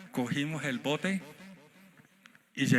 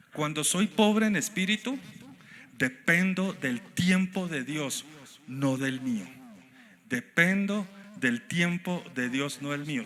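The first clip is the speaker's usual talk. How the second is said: raised, slowed preacher's declaration; preaching at full force; 3 semitones higher, 4 dB louder than usual. Real, not acted. Pastor proclaims the sermon's third point to the church, full-force preaching.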